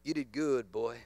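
a quiet, low dad looking up from the paper; gruff dad. Retold or imitated. Pastor imitating a father praising his son from behind the paper.

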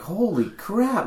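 high, drawn-out exclamation of disbelief; retold amazement. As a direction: stretch it out high, as if stunned. Retelling own stunned reaction.

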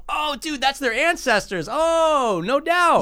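high-pitched, hammed-up dawning awe; mock realization. Viewer recognizing the characters' ancestors, mock realization.

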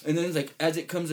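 usual speaking voice

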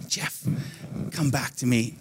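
soft, low, gentle plea; tender call. Retold or imitated. Preacher voices God picking him up after a fall, tender. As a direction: voice God quietly calling someone back.

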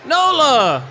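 calling out. Real, not acted. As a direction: call her name over the crowd. Calling to child in crowd.

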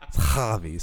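[name] said HAH-vee, low, with a heavy H; exaggerated H. Shown as a demonstration. Jokingly defending the pronunciation, [name] with a heavy H. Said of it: "I pronounce it with the H".

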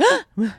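sharp high gasp of waking with a start; startled gasp. Retold or imitated. Describing waking up at a dog bark, startled gasp. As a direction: gasp as if jolted awake.

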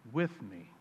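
soft, slow fatherly reassurance; tender father. Acted. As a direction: speak gently to a hurt son. Father's tender reply in parable.

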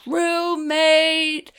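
high, long-held mock cry; mock calling out. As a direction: call it out high, holding the vowels. Imitating Eric's screamed plea.

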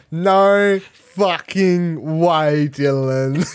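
loud, drawn-out disbelief; stretched-out stunned exclamation; mock astonished shout. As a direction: yell it slowly like your mind is blown. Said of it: "pull up his phone and go".

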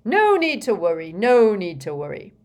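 loud, hurried reassurance; shouting. A narrator's reading. Fudge reassures the Prime Minister while leaving, shouting. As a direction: call it out loudly on the way out. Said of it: "shouted Fudge".

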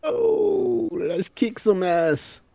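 high opening, slow drawn-out words; hammed-up battle cry. Joining the show as Obi-Wan Kenobi, hammed-up battle cry. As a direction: swoop in high and stretch it playfully.

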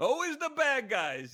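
mock sigh of a fed-up German astronaut; exasperated German. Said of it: "he's like".

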